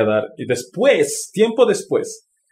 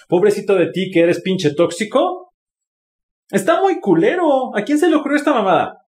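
higher, fast, indignant venting; indignant rant; 5 semitones higher than usual. Real, not acted. Ranting to the audience about a concept he hated, indignant and high. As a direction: scoff at the whole idea in disbelief.

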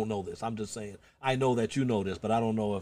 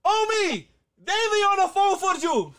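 very high, loud mock holler; hammed-up squawking call to the grandson; grandmother calling out; 6 dB louder than usual. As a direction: holler through the house as grandma. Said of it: "She was like".

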